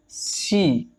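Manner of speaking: सी with a long, stretched ee; long drawn-out vowel. Shown as a demonstration. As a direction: hold the vowel in सी. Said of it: "लंबी खींच रही sound".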